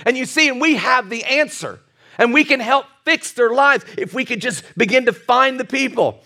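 ordinary talk, nothing marked

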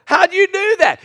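can't believe the bolt came off; stunned at the breaker bar; astonished. Retold or imitated. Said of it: "I'm like".

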